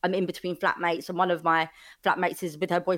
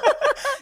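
high, teary burst of laughter; tearful laugh; 6 dB louder than usual. Real, not acted. Guest overcome by the host's kind words, tearful laugh. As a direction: laugh through the tears.

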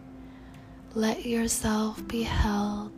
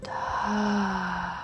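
long breathy release sigh; audible sigh out. Shown as a demonstration. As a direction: sigh it all out. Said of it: "Sigh it out".

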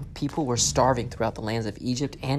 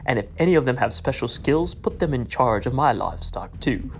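Pharaoh; 4 dB louder than usual. Acted. Pharaoh voiced giving orders.

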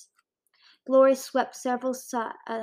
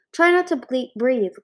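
urgent warning; 4 semitones higher, 8 dB louder than usual. A narrator's reading. Warning about ash.

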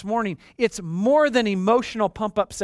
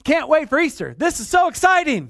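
higher, louder giddy voice; mock Tigger enthusiasm, pitched up; excited like Tigger; 7 semitones higher, 5 dB louder than usual. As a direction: bounce through it like an eager Tigger. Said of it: "are Tiggers".

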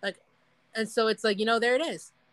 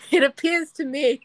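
higher, louder mock-Victorian voice; eighteen-hundreds speaker; 5 semitones higher, 5 dB louder than usual. Imagining Freud writing on his parchment, mock eighteen-hundreds speech. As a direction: put on a pompous old-time scientist. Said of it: "trying to remember how people spoke".

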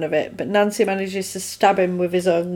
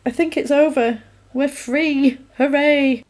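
high-pitched pretend relief; sing-out, higher-pitched hooray; mock celebration; 6 semitones higher than usual. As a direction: voice the rescued kids cheering lightly. Retold relief of the freed kids.

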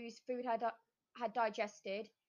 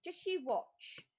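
boastful; 6 semitones higher, slower than usual. A narrator's reading. Cat boasts to witch.

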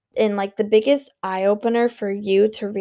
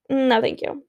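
normal talking voice

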